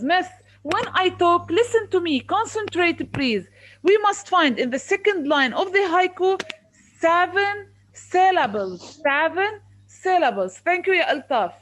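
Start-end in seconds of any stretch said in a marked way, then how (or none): 0.0-3.5 high, loud demand for attention; exasperated teacher; stern telling-off. Real, not acted. Teacher tells off inattentive pupil. 3.8-10.6 loud, insistent, "seven syllables" slowed right down; emphatic correction. Real, not acted. Teacher drums in the correct answer.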